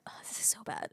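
embarrassed whisper. Real, not acted. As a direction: whisper it, mortified. Embarrassed aside while telling story.

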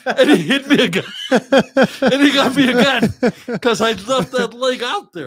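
high-pitched, loud laughing through the words; can barely get it out for laughing, slowed down. Real, not acted. Laughing while retelling the hit.

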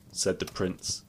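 ordinary delivery, nothing marked

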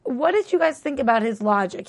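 usual speaking voice

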